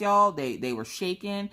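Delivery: usual speaking voice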